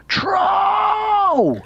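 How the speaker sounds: high shouted cry of troll; imitating a panicked scream of troll. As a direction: yell troll as a mock warning scream. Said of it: "He screams to them".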